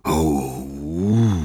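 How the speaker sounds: drawn-out moan of pain; aching "oh" stretched into a moan; long groan. A narrator's reading. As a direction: groan it out, rising at the end. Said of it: "Zeus stöhnte so laut".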